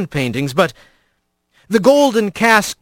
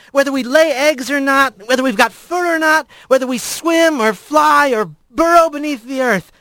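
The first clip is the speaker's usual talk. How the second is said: higher, earnest crowd speech; rally the crowd with conviction; otter speaker voice; 8 semitones higher than usual. A narrator's reading.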